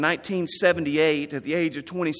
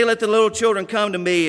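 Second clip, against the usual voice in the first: put-on louder, quick, bright calling out to kids; Robert's cheerful call; 5 semitones higher, 5 dB louder, faster than usual. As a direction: call the children over with joy. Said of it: "He would say".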